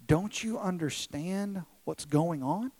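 ordinary talk

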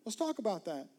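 voicing Jesus coaxing kindly; lightly voiced Jesus, emphatic; playful Jesus. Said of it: "Jesus is like".

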